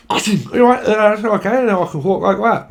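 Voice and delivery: high, loud voice with a ball in the mouth; mouth-ball speaker. Retold or imitated. Mocking someone with a tongue ball, high and loud. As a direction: talk round a mouth ball, pitched high. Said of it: "and they're like".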